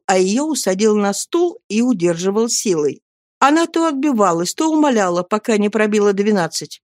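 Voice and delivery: ordinary delivery, nothing marked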